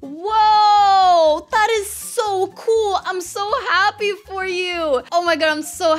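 high, loud excited squealing; thrilled outburst. Real, not acted. Player celebrates her golden penguin, thrilled and high-pitched. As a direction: burst out, then stretch the words in delight.

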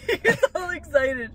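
laughing through the words, pitched high; laughing, high-pitched teasing; amused teasing. Real, not acted. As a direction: tease him fondly through a high laugh. Partner laughs while teasing his excitement.

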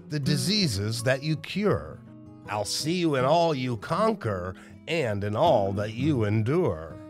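usual speaking voice

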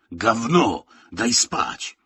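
acted grumpy retort; gruff grunt. A narrator's reading. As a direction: snap back, wanting to sleep. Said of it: "burknął Miron".

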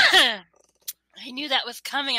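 slow, strained after choking; coughing, choking. Real, not acted. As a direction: talk through the coughing fit. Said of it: "Oh, I'm dying".

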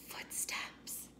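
breathy frightened whisper. A narrator's reading. Buster hearing steps outside the tent, whispering. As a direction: hush it so nobody outside hears. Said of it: "whispered Buster".

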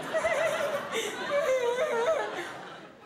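long high-pitched mock sob; mock wailing. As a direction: wail in exaggerated hurt on a high note. Mock wail after being insulted.

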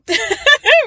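high, loud burst of laughter; amused high-pitched laugh. Real, not acted. Laughs at her own realization.

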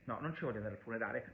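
quick, low, soft aside; mumbled self-correction. Real, not acted. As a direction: throw away a fast correction under the breath.

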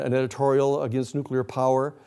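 usual speaking voice

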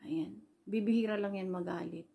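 usual speaking voice

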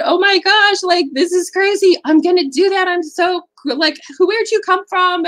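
put-on young woman at the drive-through, thrilled by the advice, squealing with excitement; excited young barista. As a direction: voice the girl bursting with disbelief and delight. Barista reacts amazed to the advice.

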